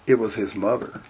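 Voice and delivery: ordinary delivery, nothing marked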